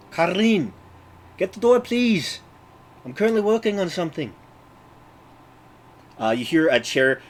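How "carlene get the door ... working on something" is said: put-on high Russian-accented woman calling out. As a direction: call from another room in a Russian accent. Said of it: "I will be butchering the Russian accent".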